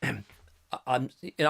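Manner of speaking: ordinary talk, nothing marked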